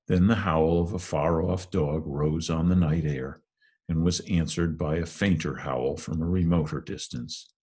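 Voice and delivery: ordinary delivery, nothing marked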